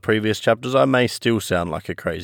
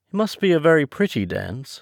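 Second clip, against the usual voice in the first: mildly timid, higher acted voice; a touch of shyness, pitched up; 7 semitones higher than usual. A narrator's reading. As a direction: offer it politely in a higher pitch. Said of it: "said Alice timidly".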